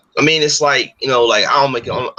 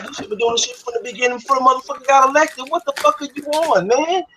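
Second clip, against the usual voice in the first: much higher, exasperated outburst; incredulous outburst; 12 semitones higher than usual. Retold or imitated. Telling people they should have united earlier, pitched high and exasperated. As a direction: let the frustration jump up high. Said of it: "I'm like".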